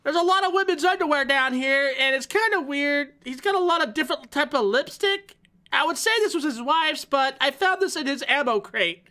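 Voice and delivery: high squeaky hamster voice. Pretend. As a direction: pitch way up like a tiny hamster. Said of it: "How can we sound like a hamster?".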